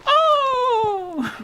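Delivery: sharp, very high cartoon grunt; high game death cry. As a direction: yelp it up high like a game unit. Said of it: "I can recreate it".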